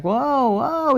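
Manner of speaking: high, drawn-out wobbly exclamation; mock alarmed whoa. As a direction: play someone caught on a roller coaster.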